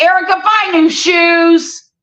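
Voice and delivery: high mock yell at daughter Erica; retold yell. Mother yelling to daughter about shoes, mock yell. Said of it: "I would scream it from the".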